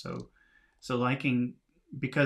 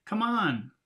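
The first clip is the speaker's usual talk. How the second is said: impatient; 9 semitones higher than usual. Shown as a demonstration. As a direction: snap come on to someone lagging behind. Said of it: "It's a little bit unpa-, impatient".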